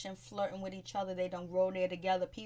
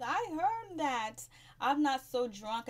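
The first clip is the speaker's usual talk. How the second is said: higher, slow, sassy mock comeback; mock sassy retort; 6 semitones higher, 4 dB louder, slower than usual. Imitating Anais overhearing them, mock indignant. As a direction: play the drunk guest snapping back, drawn out. Mimicking Anais answering back.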